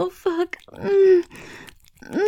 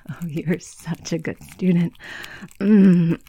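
normal talking voice

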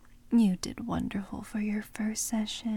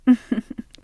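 teasing laugh; 2 semitones higher, 9 dB louder than usual. Acted.

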